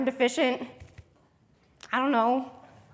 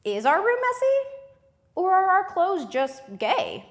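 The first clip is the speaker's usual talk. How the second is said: retold question; 8 semitones higher, 4 dB louder than usual. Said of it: "So I go".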